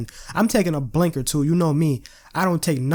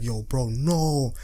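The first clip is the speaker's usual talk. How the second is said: mouthed; 2 semitones lower, much slower than usual. Retold or imitated. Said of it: "he's, like, mouthing".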